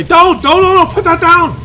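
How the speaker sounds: high, loud frantic don't don't; panicked parent. Retold or imitated. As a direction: shriek at the kid to drop the egg. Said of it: "The worst thing you can do is say".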